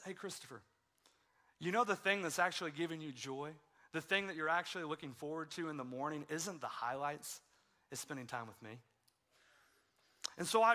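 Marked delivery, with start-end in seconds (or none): 0.1-8.8 much softer and lower, tender invitation; gentle nudge. Retold or imitated. The Lord gently inviting Christopher, soft and tender. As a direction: speak it like a quiet loving call. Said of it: "just a gentle nudge".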